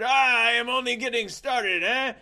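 high, loud French swagger voice; Jacques voice. Retold or imitated. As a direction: boast it like a showy French gentleman.

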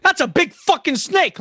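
high, emphatic outburst; high-pitched and punched, as a joke; mock exclamation. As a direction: blurt it out in big mock amazement. Mock first English phrase, outburst.

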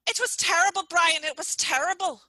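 loud, high, exasperated; emphatic exclamation. Real, not acted. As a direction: insist it was terrible.

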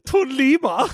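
high, mock-woeful German accent; hammy German Santa lament. German Santa losing his coal fire, high comic German accent. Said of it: "excited with his dumb accent".

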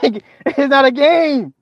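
high and slow, laughing through it; laughing, high and drawn out. Real, not acted. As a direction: laugh the words out slowly.

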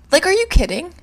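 loud, high disbelief; are you KIDDING, flung out loud; fed-up outburst; exasperated. Real, not acted. Exasperated at the doctor's questions.

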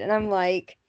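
ordinary talk, nothing marked

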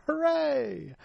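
high, thin mock cheer; sarcastic hooray. Sarcastic cheer at the long elimination.

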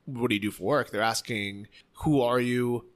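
ordinary talk

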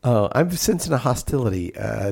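ordinary talk, nothing marked

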